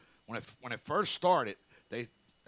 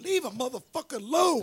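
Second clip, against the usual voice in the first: hysterical pleading; 13 semitones higher, 8 dB louder than usual. Retold or imitated. Leave [name] Alone meme riff.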